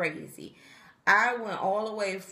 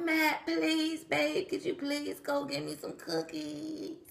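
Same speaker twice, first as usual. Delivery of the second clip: pleading; 9 semitones higher, slower than usual. Retold or imitated. Begging husband for cookies.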